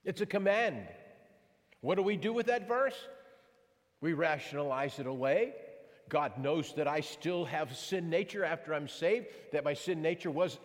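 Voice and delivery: fervent pulpit exhortation; preaching at full force. Acted. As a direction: push the challenge to the congregation. Preacher presses the congregation.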